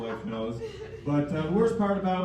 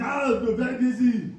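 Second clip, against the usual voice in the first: lightly higher Austrian Arnold voice; Arnold accent; 6 semitones higher than usual. Retold or imitated. Arnold turning down the comedy show, light Austrian accent. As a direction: brush off with a mild Austrian lilt. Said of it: "He's like".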